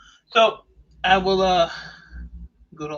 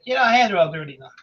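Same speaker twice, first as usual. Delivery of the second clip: ordinary talk, nothing marked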